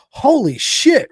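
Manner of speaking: slow, very high and loud mock amazement; a retold gasp of disbelief, drawn out; stunned exclamation. Lady reacts on the phone.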